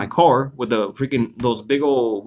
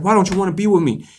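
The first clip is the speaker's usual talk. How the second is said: quick, higher-pitched mock plea; imitated ex-girlfriend; 6 semitones higher, faster than usual. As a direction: voice the ex demanding an answer, higher and hurried. Voicing the crazy ex in story.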